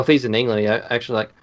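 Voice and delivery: ordinary talk, nothing marked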